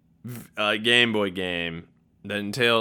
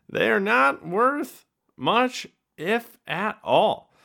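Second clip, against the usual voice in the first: lightly put-on high voice, slow; mock voice; 10 semitones higher, much slower than usual. Telling viewers the cards are worth nothing, in a slow, high mock voice. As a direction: drawl out the letdown in a silly voice.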